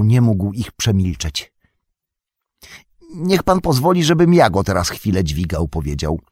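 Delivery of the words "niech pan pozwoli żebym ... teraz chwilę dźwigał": wyżej, przejęty, błagalny Sam; głos Sama, pełen litości. A narrator's reading. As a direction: Sam z litością prosi, że poniesie ciężar. Sam voiced by narrator. (Sam z litością ofiarowuje się nieść ciężar, głosem wyższym)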